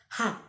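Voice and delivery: "Ha" with a plain breathy h, just an exhale; "Ha" said with an easy English h; simplified h. Shown as a demonstration. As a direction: breathe out the h with no throat scrape. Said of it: "pronounce it like this".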